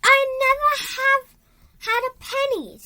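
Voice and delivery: put-on high, fast, loud little girl's voice; Flora's small voice. A narrator's reading. Flora protesting to the greedy landlords, high and quick. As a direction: squeak it out like a startled girl. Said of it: "said Flora".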